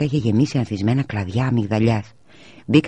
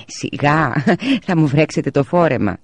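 laughing; 5 semitones higher, 3 dB louder than usual. A narrator's reading. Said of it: "είπε γελώντας η μαμά".

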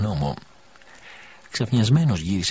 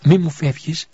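higher, louder, urgent pleading man; a man begging the dying woman he loves to stay, higher and louder; pleading lover; 7 semitones higher, 5 dB louder than usual. A narrator's reading. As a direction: beg her to stay with you. Elias pleads with the dying widow.

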